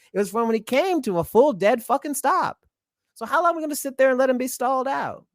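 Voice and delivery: heated, high-pitched indignation; indignant. Real, not acted. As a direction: drive the grievance home, voice rising high. Indignant rant about the missed caution.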